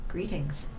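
the Beggar King's hushed hello; quiet menace. Acted. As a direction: let it slide in from behind, cold. Sorcerer appearing behind him.

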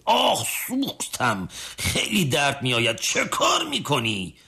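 pained outcry. A narrator's reading. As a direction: moan it out as if burning. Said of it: "باز فریادش بلند شد".

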